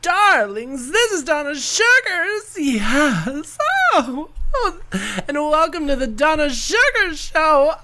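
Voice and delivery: high, bright showbiz hostess welcome; giddy diva greeting. Real, not acted. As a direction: gush it like a glamorous host. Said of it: "I'm like, I was giddy".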